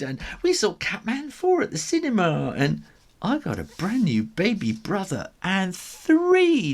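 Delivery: very high, eager kitten chatter; excited kittens. A narrator's reading. Kittens telling holiday news, high and excited. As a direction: tumble over each other with news.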